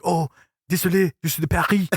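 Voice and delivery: high, apologetic mock French reply; imitating a Parisian. Parisian stranger answering in French.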